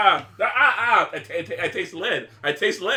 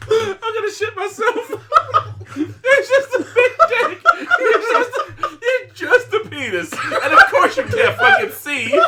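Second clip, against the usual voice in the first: helpless laughter; 13 semitones higher, 5 dB louder than usual. Real, not acted. Friend cracks up at the huge dick card, helpless laughter. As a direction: barely get it out through laughing.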